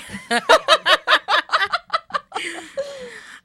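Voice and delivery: loud high burst of laughter; big laugh. Real, not acted. Joke about being overweight.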